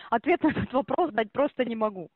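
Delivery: ordinary delivery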